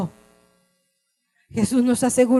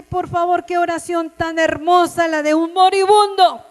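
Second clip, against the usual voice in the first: impassioned preaching; 8 semitones higher, 7 dB louder than usual. Acted. Preacher describing Jesus praying for the world, loud fervent preaching. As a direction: cry it out to the congregation.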